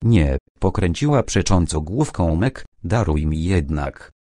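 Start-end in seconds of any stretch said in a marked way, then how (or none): none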